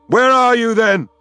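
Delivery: loud, high demand; raging call to the silent spirits; bellowing. A narrator's reading. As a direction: shout the question at the empty sky.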